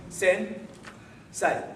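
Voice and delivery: SEN, then a clear gap, then SAY; pause mid-word. Shown as a demonstration. Karate teacher showing a class the mistake, paused mid-word. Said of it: "there was a pause in between".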